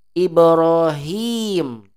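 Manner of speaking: Ibrahim with the hi held long and slow. Shown as a demonstration. Teacher modelling Ibrahim for students, long drawn hi. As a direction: stretch the hi of Ibrahim out fully. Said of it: "itu bacanya".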